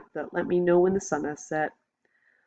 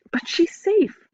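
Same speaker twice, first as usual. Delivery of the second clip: high loud desperate plea; pleading; 12 semitones higher, 5 dB louder, slower than usual. A narrator's reading. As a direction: insist she is safe. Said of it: "I say".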